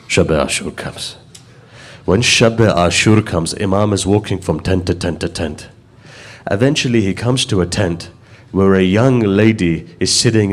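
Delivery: low, hushed grieving telling of the martyrdom; hushed mournful recitation. Acted. Preacher to mourners at majlis, hushed grieving lament.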